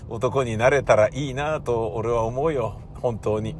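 normal talking voice

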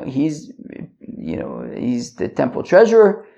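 normal talking voice

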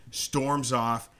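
ordinary delivery

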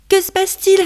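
high, loud panicked cry; little ladybug in a panic. Acted. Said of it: "dit-elle paniquée".

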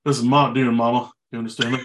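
lightly voiced singer impression. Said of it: "doing the [name] voice for Dune two".

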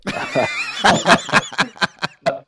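a burst of loud laughter, way up high. Real, not acted. Laughing at his wife's differing view, loud laugh.

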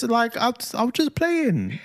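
high-pitched, drawn-out mock protest; imitating a defensive child. Dad voicing his older son excusing himself, mock defensive. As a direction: plead innocence in a high kid voice.

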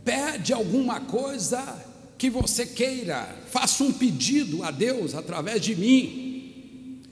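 slow, high, declaimed Bible telling; solemn preaching narration. Acted. Pastor voicing Elijah's offer to Elisha, slow declamatory preaching. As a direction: declaim it slowly to the congregation.

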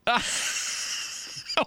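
breathy, high laugh. Real, not acted. Host reacting to co-host's cannibal joke, laughing. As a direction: burst out laughing.

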